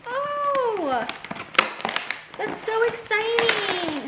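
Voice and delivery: light up at an unexpected gift; high, drawn-out delighted exclamation; excited gasp. Real, not acted. Opening surprise publisher package.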